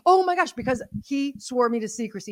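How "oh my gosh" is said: high, loud burst of excitement; excited gasp. Retold or imitated. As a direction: blurt it out like big news you can't keep in. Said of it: "I wasn't allowed to say".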